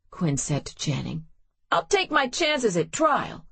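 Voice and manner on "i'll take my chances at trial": higher, stubborn defiance; defiant. Acted. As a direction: dare them. Quinn defiant toward Channing.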